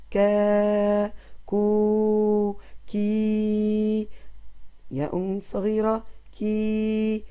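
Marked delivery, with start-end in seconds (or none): 0.1-4.1 كا كو كي each stretched, with long vowels; long vowel drill. Shown as a demonstration. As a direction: chant كا كو كي slowly for the kids to repeat. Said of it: "رددوها معي".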